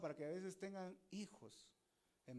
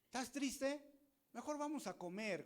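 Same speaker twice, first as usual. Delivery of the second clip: higher, louder, breezy cheer; mock cheery husband; 8 semitones higher, 5 dB louder than usual. Husband cheerfully offering food to weeping wife. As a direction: brightly push food as the fix.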